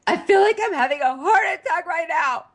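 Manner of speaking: high mock dramatic girl; mock panic. Mocking Ashley's drama, high pitched. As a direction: fake a heart attack, high and breathless. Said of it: "Ashley is like".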